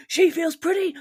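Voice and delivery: high, loud imitation of outraged haters; mock anger. Podcaster imitating people who hate the actress, in mock anger. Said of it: "like, anger".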